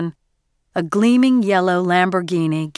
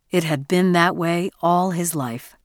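plain reading voice

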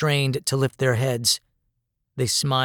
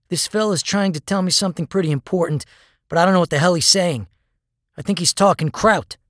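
higher, louder, fast and anxious; urgent; 6 semitones higher, 5 dB louder, faster than usual. A narrator's reading. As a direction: press the captain for help. Urgent plea to captain.

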